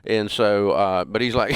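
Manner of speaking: ordinary talk, nothing marked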